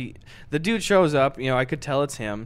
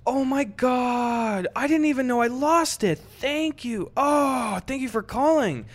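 much higher, slow gushing voice; gushing caller; 8 semitones higher than usual. Retold or imitated. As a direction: overdo the relieved thanks. Owner gushing thanks, quoted.

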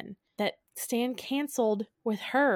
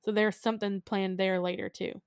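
ordinary delivery

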